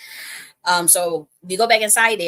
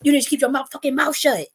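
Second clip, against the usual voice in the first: high, loud, exasperated order; imitated pissed-off scolding; 7 semitones higher, 4 dB louder than usual. Man scolding her for talking too much, imitated angry order. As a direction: snap it as the fed-up man who means no harm. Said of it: "trying to channel the energy of how he said it".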